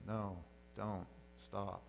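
soft, low token objection; half-hearted protest. Retold or imitated. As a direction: object quietly without meaning a word of it. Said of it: "he wants her to go".

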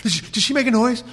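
high, light imitation of his wife; the wife startled awake by a faint noise, high and light; sleepy wife. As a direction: mimic his half-asleep wife asking. Wife waking, voiced in story.

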